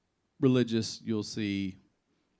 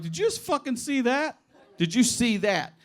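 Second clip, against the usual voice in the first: high, laughing disbelief; stunned disbelief; 13 semitones higher, 5 dB louder than usual. Retold or imitated. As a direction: yell it half laughing in shock. Disbelief right after the crash.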